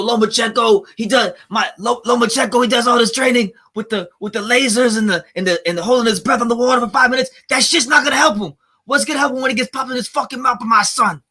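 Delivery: T O Senior quote. Retold or imitated. Streamer retelling the boxing dad's trash talk about Lomachenko. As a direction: rant like a proud boxing dad. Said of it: "did y'all miss when he said".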